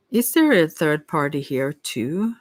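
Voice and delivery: usual speaking voice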